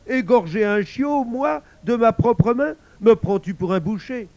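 high, loud horrified protest; yelping outrage. A narrator's reading. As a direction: protest at full voice, appalled. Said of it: "glapit grimaçant".

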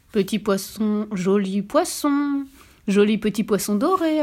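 usual speaking voice

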